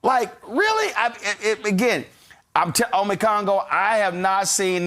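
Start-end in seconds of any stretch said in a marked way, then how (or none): 0.0-0.9 mock disbelief. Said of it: "And go".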